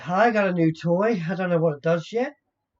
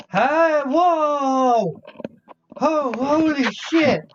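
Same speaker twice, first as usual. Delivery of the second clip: high, loud yelps of shock; startled alarm; 8 semitones higher, 4 dB louder, much slower than usual. Acted. Adventurer suddenly facing giant crossbows, startled alarm. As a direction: cry out, drawing the words out. Character faces forty aimed crossbows.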